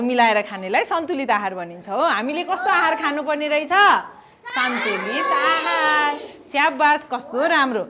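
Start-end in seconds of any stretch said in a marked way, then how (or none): 4.4-6.2 slow choral answer. Real, not acted. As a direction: answer slowly as a class. Class answers together. (बच्चाहरू सँगै बिस्तारै)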